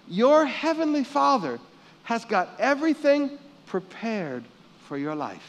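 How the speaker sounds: usual speaking voice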